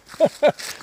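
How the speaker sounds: a high, short burst of laughter; real laugh. Real, not acted. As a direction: laugh out loud at the absurdity. Laughing mid-story.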